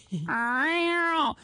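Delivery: drawn-out falling cat meow; a playful retold cat meow; imitated meow. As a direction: mimic the cat calling from the fence.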